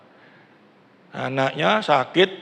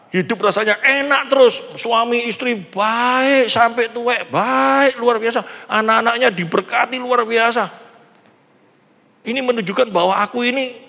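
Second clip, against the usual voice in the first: mock rosy praise; 6 semitones higher than usual. Describing the blessed carefree life.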